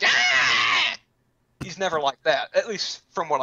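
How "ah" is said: short sudden mock yell; mock shout. Showing how the bear never yells, a quick mock shout. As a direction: bark out a brief hammed-up cry. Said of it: "he doesn't ever get like".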